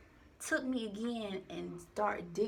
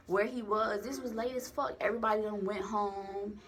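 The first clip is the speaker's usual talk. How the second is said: normal talking voice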